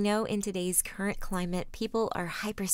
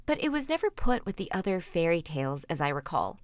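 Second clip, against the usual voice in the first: usual speaking voice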